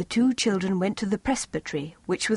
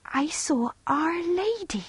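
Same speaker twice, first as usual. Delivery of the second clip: whispered secret; 8 semitones higher, 3 dB quieter, slower than usual. A narrator's reading. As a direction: whisper it, slow and awed. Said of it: "You'll never guess".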